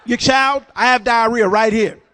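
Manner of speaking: loud call across a crowded room; calling loudly to a stranger across the food court; yelling across food court. Retold or imitated. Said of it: "I yelled across the food court".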